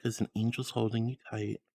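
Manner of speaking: soft, low, tender hush; soothing lull. Acted. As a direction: soothe them like a lullaby. Soothing sign-off to chat.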